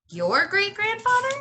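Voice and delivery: high, lifted question; can't believe it's that old; amazed question. Acted. Girl amazed by the librarian's great-grandfather, high and lifted.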